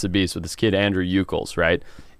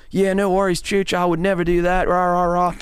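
higher, put-on Australian accent; 8 semitones higher than usual. Producer quoting Andrew's easygoing reply in an Australian accent. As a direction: imitate a laid-back Australian mate. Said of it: "That's my best Australian".

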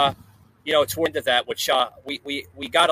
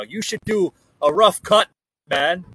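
put-on a deliberately terrible Irish accent, pitched up and louder; mock Irish brogue delivered with a grin; bad Irish accent; 4 semitones higher, 6 dB louder than usual. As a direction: ham up an Irish lilt, badly. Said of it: "that was the worst impersonation of an Irish accent".

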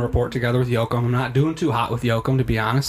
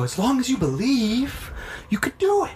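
mock encouragement; 11 semitones higher than usual. Friends urging on a would-be swimmer, mock encouragement.

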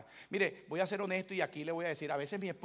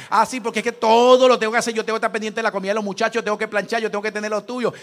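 loud, fast indignant wife outburst; hammed-up angry complaint from the wife; imitating his wife's loud tirade; mock wife rant; 12 dB louder than usual.

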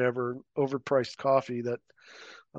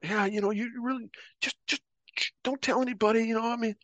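higher, wheedling plea; lightly voiced sheepish begging; mock pleading; 9 semitones higher than usual. Guy begging him to keep quiet.

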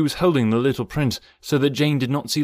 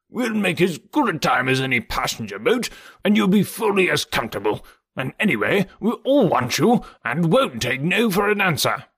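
higher-pitched, emphatic gentleman's voice; hearty Lord Tennington; 7 semitones higher than usual. Acted. Tennington urging a guest to join the boat, hearty and insistent. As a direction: press the invitation with lively swoops in pitch. Tennington's speech in narrated story.

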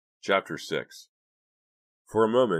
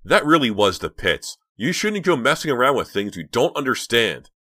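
loud, raised scolding voice; angry loud rebuke; 7 semitones higher, 9 dB louder than usual. A narrator's reading. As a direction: tell him off hard. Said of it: "anger that filled her body".

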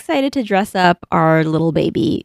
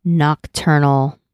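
slow flat serious monotone; 4 semitones lower, much slower than usual. Acted. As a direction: drag it out deadpan. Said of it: "what was that flat voice you were doing".

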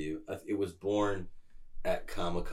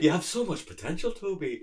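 higher, lightly voiced mentor encouragement; mock earnest pep talk, imitated; Ewan McGregor impression; 9 semitones higher, 4 dB louder than usual. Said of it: "[name] jumps into his perfect McGregor".

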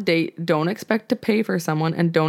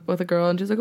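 normal talking voice

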